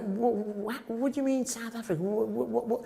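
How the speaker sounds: high, stammering mock bafflement; baffled disbelief. London classmates hearing South Africa, imitated in stammering disbelief. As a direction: imitate someone stuck on a puzzled "what".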